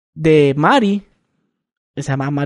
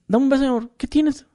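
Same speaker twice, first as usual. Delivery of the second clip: put-on higher, sweet coaxing girlfriend; 7 semitones higher than usual. Girlfriend asking her boyfriend for a kiss, higher and sweet. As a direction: coax him like a clingy girlfriend. Girlfriend voiced in retold story.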